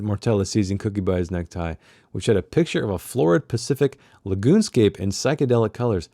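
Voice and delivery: usual speaking voice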